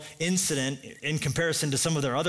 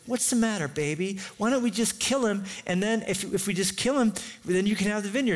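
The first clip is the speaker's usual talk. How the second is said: higher-pitched mock wife voice; Jezebel; 5 semitones higher than usual. Wife offers her sulking husband a plan to kill, high and coaxing. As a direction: coax him in a put-on high voice. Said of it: "And she goes".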